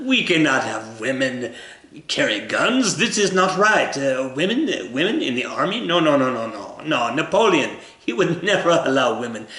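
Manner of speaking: hammed-up, indignant French accent; awful French accent. The French objecting to women carrying guns, awful French accent. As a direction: protest in a bad mock French accent. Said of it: "awful French accent".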